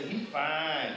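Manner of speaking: laughing mock girl's admiring aside; mock girl's admiring comment with a laugh; imitating a girl. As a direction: tease it like a girl eyeing a boy.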